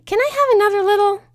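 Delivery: high, loud and bouncy; bubbly Pinky voice. Acted. Pinky darting off toward Sea Swirl, high and giddy. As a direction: squeal it out like an excited pony.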